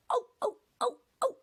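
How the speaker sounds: squeaky high trucks crying oh; high squealing cries from silly trucks; screaming trucks. Acted. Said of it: "they cried again".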